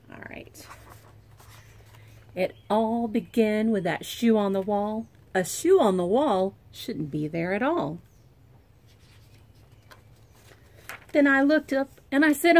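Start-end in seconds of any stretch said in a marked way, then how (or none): 2.3-12.6 put-on lively read-aloud for kids; story reading. A narrator's reading. Teacher reading a picture book to her pre-K class, lively. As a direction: read it like circle time for little ones.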